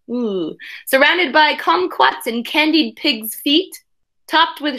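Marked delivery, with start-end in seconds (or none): none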